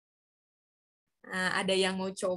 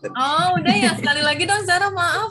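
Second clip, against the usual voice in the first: loud call; 6 semitones higher, 9 dB louder than usual. Real, not acted. Asking the student to repeat.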